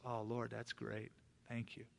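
thinking to myself. Retold or imitated. Pastor silently thanking God for the deal, soft and relieved. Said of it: "I'm thinking to myself".

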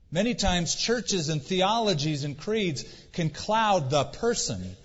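ordinary talk, nothing marked

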